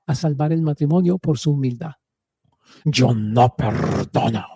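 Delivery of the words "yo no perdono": low, slow, dark and heavy; a deep demonic-sounding refusal; voice of the unforgiving woman. Shown as a demonstration. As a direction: growl it out like something demonic. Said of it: "con la voz a la que corresponde ese no perdón".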